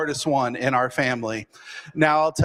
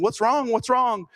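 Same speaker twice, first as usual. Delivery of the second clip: retold yelling; 7 semitones higher than usual. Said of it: "everyone's yelling".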